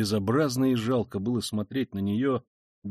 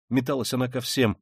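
ordinary delivery, nothing marked

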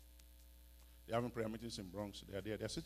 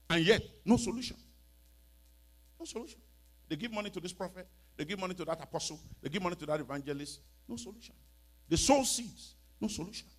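preaching refrain; 6 semitones higher, 6 dB louder than usual. Real, not acted.

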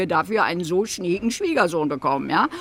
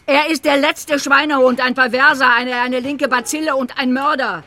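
heated accusing rant, voice raised and higher; angry tirade; 4 semitones higher, 3 dB louder than usual. Acted. Mother denounces Harry to the writer, angry tirade. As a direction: pile up the insults furiously.

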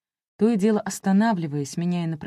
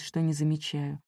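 ordinary delivery

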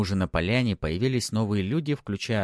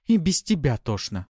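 irritated; 7 semitones higher, 4 dB louder than usual. A narrator's reading. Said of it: "огрызнулась она в ответ".

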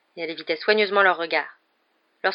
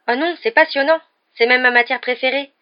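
high, louder, keen delight; eager, raised-pitch enthusiasm; enthusiastic; 6 semitones higher, 7 dB louder than usual. A narrator's reading. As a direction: gush about a favourite thing. Hermione enthuses over favourite subject.

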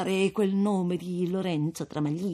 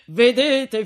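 laughing through the word, louder; 7 semitones higher, 8 dB louder than usual. A narrator's reading. Priest laughing to the young couple, gleeful. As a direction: start the speech on a laugh.

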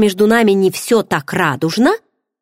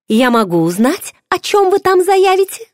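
much higher, inquisitive rise; ask, eager to know, pitched up; curious; 13 semitones higher, 4 dB louder than usual. A narrator's reading. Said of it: "полюбопытствовала она". (с любопытством, высоко)

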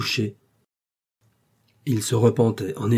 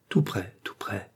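slow whisper right in the ear; whispered and unhurried, for one listener only; intimate whisper; 6 dB quieter than usual. A narrator's reading. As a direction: draw someone close with a slow, hushed whisper. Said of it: "que je vous le dise à l'oreille".

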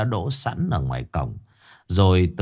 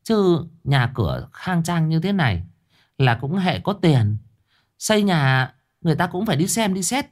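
a lightly voiced, higher old gossip; gossiping neighbor; 7 semitones higher than usual. A narrator's reading. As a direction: insist you are right. Another neighbor arguing.

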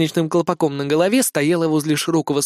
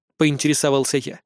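plain reading voice

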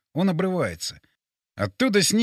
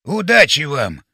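loud, drawn-out send-off; big-voiced farewell; calling out; 8 dB louder, slower than usual. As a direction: call it across slowly and loudly. Calling out at launch.

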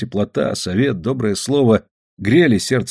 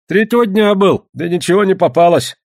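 high, loud peasant reply; much higher voice, complaining of no luck; villager's character voice; 9 semitones higher, 5 dB louder than usual. A narrator's reading. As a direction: answer in a pitched-up, agitated voice. Fyodor answering the hunter's questions.

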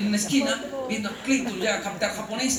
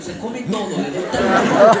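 slow, much louder laughing scold; laughing mock mother; 13 dB louder, slower than usual. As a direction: voice mom's silent glare. Mother's eyebrows speaking.

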